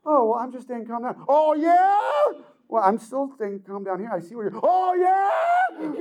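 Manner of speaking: high, hammed-up yelling; hammed-up shouting, climbing the anger ladder; mock escalating shout. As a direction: play the calm one losing it comically. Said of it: "They never go".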